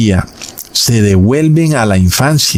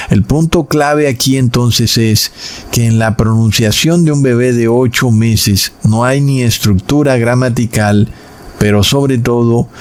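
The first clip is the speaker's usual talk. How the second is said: ordinary talk, nothing marked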